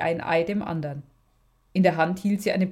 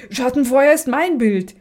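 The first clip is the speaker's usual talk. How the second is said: higher and much louder, fighting on the floor; shouting; 6 semitones higher, 8 dB louder than usual. Acted. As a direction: scream it mid-brawl. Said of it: "schrie eine der Schwestern".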